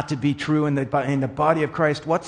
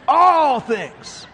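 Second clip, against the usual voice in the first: higher, still raised repeat, a step down from the shout; preaching emphasis; 10 semitones higher, 4 dB louder than usual. Real, not acted. Preacher repeating the phrase to the church, raised emphasis. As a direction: echo it with less force.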